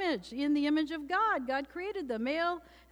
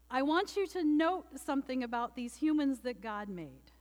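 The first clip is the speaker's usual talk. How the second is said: ordinary talk, nothing marked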